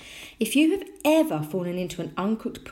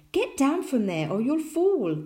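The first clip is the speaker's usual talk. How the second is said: higher, loud motherly warning; alarmed scolding; 8 semitones higher, 5 dB louder than usual. A narrator's reading. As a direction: call out a worried order to a child. Mother scolds climbing child.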